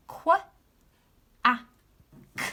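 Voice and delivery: Qu- a k said as separate sounds; sounding out. Shown as a demonstration. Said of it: "Let's read it together".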